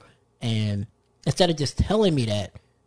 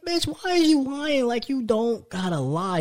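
mock exasperated; 9 semitones higher, slower than usual. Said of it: "I'm like".

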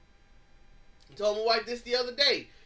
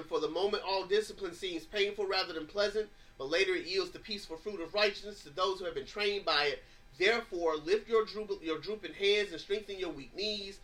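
lower, softer reading of the verse; scripture reading; 4 semitones lower, 4 dB quieter than usual. Acted. Preacher reading scripture to the congregation, soft and lowered. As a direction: read the verse gently and evenly.

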